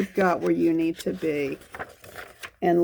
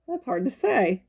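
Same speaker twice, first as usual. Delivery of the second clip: plain reading voice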